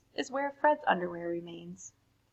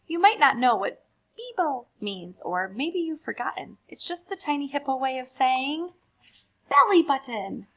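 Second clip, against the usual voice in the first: lively, animated poem reading; reading to children; 4 semitones higher, 4 dB louder than usual. A narrator's reading. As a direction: read it brightly for a young audience. Reads poems to children.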